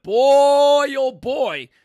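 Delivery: drawn-out, high, booming exclamation; loud exclamation. Real, not acted. Exasperated host exclaiming over police controversies, loud exclamation. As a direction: belt it out, stretching the first word.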